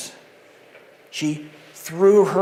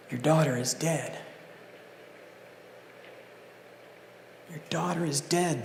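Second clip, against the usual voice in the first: somber news; 3 semitones lower, 12 dB quieter than usual. Acted. Messengers' news voiced.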